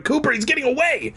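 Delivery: quick, urgent call; urgent bark. A narrator's reading. Fergie warning Cooper the phony escapes, quick urgent call. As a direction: hurry him along. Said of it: "Fergie barked".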